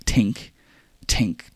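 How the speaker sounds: ordinary talk, nothing marked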